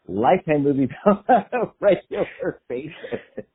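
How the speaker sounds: real laughter breaking through the words; laughing. Real, not acted. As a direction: laugh your way through the admission. Laughs while admitting her viewing habit.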